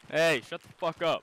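loud joking snap back. Real, not acted. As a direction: bark it at a teasing friend. Teammate snaps back at teasing.

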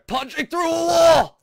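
loud, high battle yell; mock rage outburst. Player venting anger the "right way", high yell.

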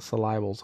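"syllables" as suh-LIE-bulls; "syllables" said suh-lie-buls; deliberate mispronunciation. Shown as a demonstration. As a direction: stress the middle of "syllables" like "lie". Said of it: "how I used to say it".

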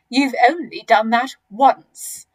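loud, slow put-down; mildly snorting crocodile scorn; scornful snort. A narrator's reading. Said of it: "snorted the not so big one".

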